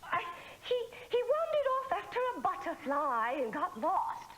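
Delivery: fake Swedish accent. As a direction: pose as a Swedish camp counsellor on the phone. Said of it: "You sound funny with that accent".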